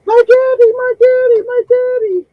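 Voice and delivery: put-on very high, loud child wail; little girl wailing for her dad, very high; crying little girl. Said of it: "the little kid going".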